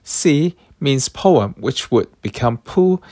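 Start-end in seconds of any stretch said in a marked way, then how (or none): 0.0-0.5 "C" on a high, level tone; Cantonese tone one. Shown as a demonstration. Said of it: "Tone one".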